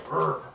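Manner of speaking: "Rrr" said as a buzzing r sound; rolled r sound. Shown as a demonstration. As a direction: voice "Rrr" as one long r. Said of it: "you just go".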